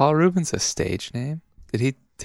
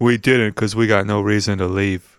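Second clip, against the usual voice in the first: mock New Jersey local, a touch louder; New Jersey accent; 3 semitones lower, 3 dB louder than usual. Jersey locals bragging at the diner, New Jersey accent. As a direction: boast like a Jersey guy who never leaves. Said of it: "New Jersey".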